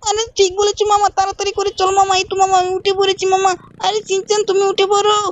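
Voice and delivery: put-on high, pleading little-boy voice; Shinchan child voice. Shinchan nagging his uncle, high childish voice. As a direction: pitch way up and whine at mama like a small kid.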